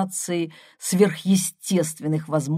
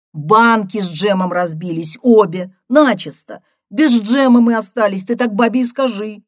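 loud, raised phone voice; insistent sister; 4 semitones higher, 7 dB louder than usual. A narrator's reading. Sister repeating the jam news to brother, loud and insistent. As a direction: drive each detail home loudly.

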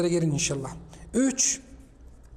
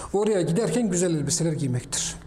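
ordinary talk, nothing marked

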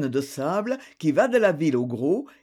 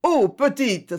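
higher, louder happy cry; mild delighted exclamation; exclaiming; 7 semitones higher, 4 dB louder, slower than usual. A narrator's reading. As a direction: cry out in glad surprise. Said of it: "s'écria-t-il".

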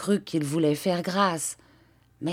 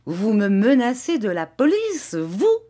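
higher, incredulous angry challenge; Garofoli, furious; 5 semitones higher, 4 dB louder than usual. A narrator's reading. Padrone threatened with the police, furious. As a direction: throw the threat back in outrage. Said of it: "la fureur du padrone".

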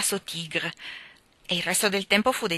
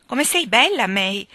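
higher, louder delighted praise; admiring; 4 semitones higher, 8 dB louder, slower than usual. Acted. Fiona praising little May's dress, delighted. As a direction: gush over the child.

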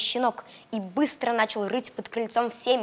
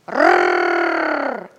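growling dog; 2 semitones lower, 9 dB louder than usual. Acted. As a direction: growl like a big shaggy dog. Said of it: "зарычал пёс".

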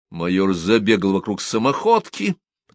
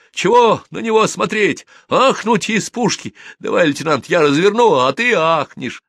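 high, loud acted outburst; shouting; 11 semitones higher, 5 dB louder than usual. A narrator's reading. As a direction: yell it out in a heated rant. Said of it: "а потом закричал". (раздражённый крик, высоко и громко)

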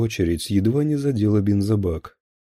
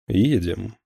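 short, firm command; understated commanding tone; order. A narrator's reading. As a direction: give it as a brief order. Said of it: "приказал Звягинцев".

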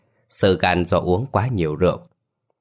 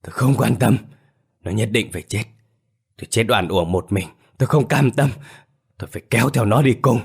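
hissing ghost; 4 semitones higher than usual. A narrator's reading. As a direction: spit the words out, vengeful and bitter. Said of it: "Vòng ma thằng Tỉnh rít".

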